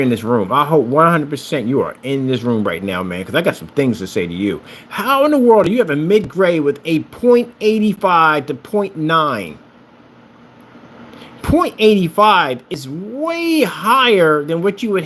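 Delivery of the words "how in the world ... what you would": exasperated rant. Real, not acted. Ranting at rival company owner.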